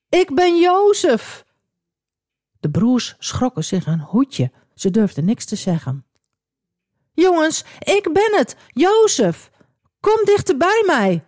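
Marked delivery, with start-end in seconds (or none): 0.1-1.4 high, slow, tearful cry of joy. A narrator's reading. Jozef reveals himself to his weeping brothers, crying out high and slow. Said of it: "En hij riep". 7.1-11.2 high, eager calling out. A narrator's reading. Jozef calls his frightened brothers closer, calling out high. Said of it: "riep hij".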